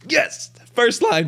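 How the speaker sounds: excited yelp, voice cracking high. Real, not acted. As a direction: voice breaks up in excitement. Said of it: "Nice voice crack".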